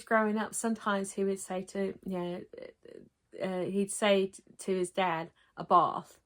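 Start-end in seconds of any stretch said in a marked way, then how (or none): 5.6-6.2 "bath" with a long broad ah, "bahth"; "bath" as the son says it to his English dad; British bath. Retold or imitated. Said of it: "'cause my husband says".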